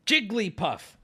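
Jigglypuff said as Jig-lee-puff, falling; three syllables. Shown as a demonstration. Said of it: "She says her name with three syllables".

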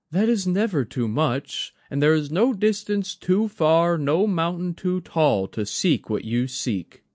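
put-on gentle, encouraging young woman; Mai, softened. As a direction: comfort him gently as Mai.

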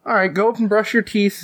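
high-pitched mother voice; bedtime mom. Acted. Mom sending kid to brush teeth, high coaxing voice. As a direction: instruct a child in a high mom voice.

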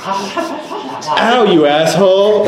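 high yelp as a poked deer. Acted. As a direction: cry out high, half laughing, like a poked deer. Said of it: "You say that as deer?".

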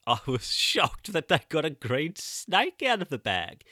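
laughing as she admits being scandalised; laughing through words. Real, not acted. Telling a colleague the snake story, laughing. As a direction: giggle through the line.

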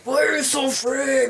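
booming stern Darth Vader; harsh accusing Vader take. Retold or imitated. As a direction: boom it like a stern villain. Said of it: "like Darth Vader voice or something".

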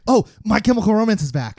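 high-pitched excited outburst. Real, not acted. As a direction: blurt it out thrilled. Excited news announcement.

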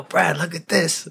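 high, drawn-out call to a buddy; imitating an eager show-off; excited showing off. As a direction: call him over to watch your trick. Acting out friend showing off.

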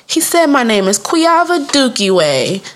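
put-on slow, high little-girl complaint; aggrieved child. Little girl repeating the boy's taunt to her teacher, aggrieved. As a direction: draw out the mocked name, high and wronged.

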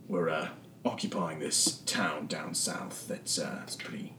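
low, soft, grumbling henchman; a bored crook spilling his orders; low surly thug Knocker. Acted. Captured thug Knocker answering the party, low and grumbling.

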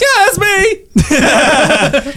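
high, slow giggly yell; laughing. Real, not acted. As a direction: laugh out the line. Player laughs in character.

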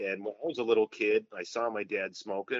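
ordinary talk, nothing marked

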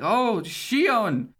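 high, half-hearted yell; retold yell. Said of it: "Roxas yells at her".

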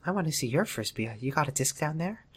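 whisper-soft sleazy charm; hushed mock-seductive college guy; creepy Wes voice. Wes voice continued.

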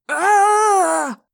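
long, high, loud scream; agonized cry. A narrator's reading. Teacher clawing at his head, screaming. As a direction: hold a piercing scream of pain. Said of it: "the teacher cried".

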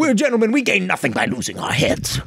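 gentleman voice. Pretend. Mock old-fashioned gentleman.

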